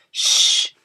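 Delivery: a quick hiss for silence; the others hushing the centipede, whispered shh; whispered shush. A narrator's reading. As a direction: hush them with a sharp shh. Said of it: "the others whispered".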